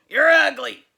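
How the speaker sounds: put-on nasty monster boy. Sammy snarls at Leslie, loud and nasty. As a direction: snap it like a rotten little creature.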